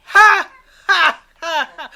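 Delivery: high, loud mock villain laugh; imitating Joker laugh. Host imitating the Joker's terrifying laugh, loud mock cackle. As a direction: cackle it like a creepy clown. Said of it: "his laugh is terrifying".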